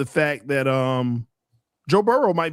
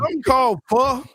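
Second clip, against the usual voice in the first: mocking; 9 semitones higher, faster than usual. Mocking an odd food name.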